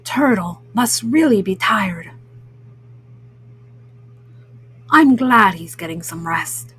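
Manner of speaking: lightly voiced low, kindly cat; Pete the Cat. Kindly cat thinking about his sleeping friend, lightly voiced and low. Voices Pete's kind thought.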